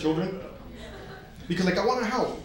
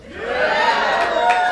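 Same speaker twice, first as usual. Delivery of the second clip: high, loud shouted yeah; answering the crowd about La Puente with a loud cheer; hometown cheer; 7 dB louder than usual. Real, not acted. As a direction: yell it like a proud local.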